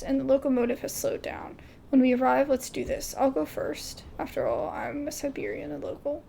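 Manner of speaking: ordinary delivery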